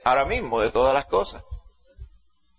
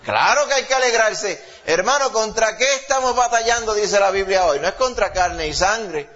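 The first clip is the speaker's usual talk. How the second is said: high, raised preaching voice; fervent preaching; 7 semitones higher than usual. Acted. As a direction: drive the point home to the church. Preaching at full force. (predicación ardiente y en voz alta)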